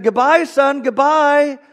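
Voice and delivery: loud, high answering call; shouted reply. Retold or imitated. Lady at the exit calling back to the man, shouting. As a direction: holler back across the store. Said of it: "shouted out across the store".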